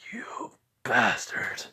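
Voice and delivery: amused whisper through a grin; whispered with a smile. Retold or imitated. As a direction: hiss it softly while smiling. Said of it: "I'm saying that with a smile".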